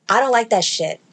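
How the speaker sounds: normal talking voice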